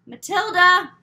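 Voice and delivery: loud high call up the stairs. A narrator's reading. Mother calling daughter up the stairs, loud. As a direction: shout her name from below.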